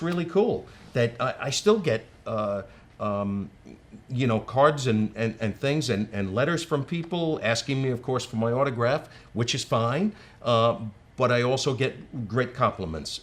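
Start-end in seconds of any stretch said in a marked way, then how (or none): none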